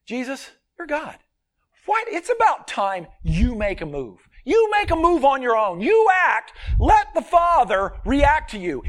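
voicing the tempter. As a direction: push him to act like a tempter. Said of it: "The temptation was".

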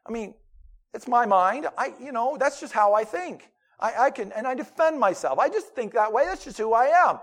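defensive believer. Retold or imitated. Pastor mimicking a believer who insists his mind is his own, mock protest. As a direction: play the stubborn church member defending his thoughts.

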